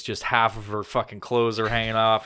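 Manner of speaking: ordinary talk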